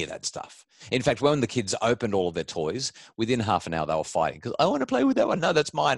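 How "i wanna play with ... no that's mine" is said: put-on high whiny kid voice, raised; bickering child, much higher; squabbling kid. Kids fighting over toys.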